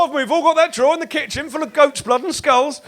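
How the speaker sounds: usual speaking voice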